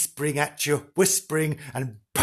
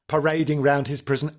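ordinary talk, nothing marked

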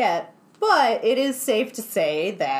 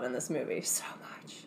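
whispering; 8 semitones lower, 13 dB quieter than usual. Real, not acted. Gushing about scary scenes.

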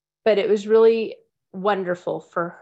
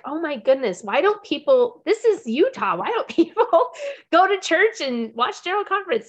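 put-on exasperated teen; 8 semitones higher, 4 dB louder than usual. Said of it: "she was like".

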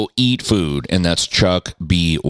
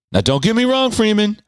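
imitating Chuck. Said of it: "He said".

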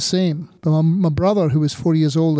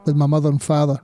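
ordinary talk

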